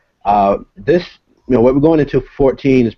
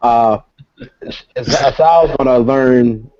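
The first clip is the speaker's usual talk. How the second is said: stuttered start, then slow and careful; halting, guarded stammer; stuttering; 3 semitones higher, much slower than usual. Real, not acted. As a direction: trip on the first sound, then ease it out. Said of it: "that's why I'm stuttering".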